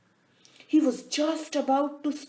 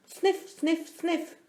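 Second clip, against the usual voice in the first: sniffing; 6 semitones higher, slower than usual. A narrator's reading. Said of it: "stopped his sniffle sniffle and gave a".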